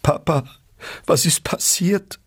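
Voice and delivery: put-on high, scared little girl's voice; child's alarmed question; frightened child. A narrator's reading.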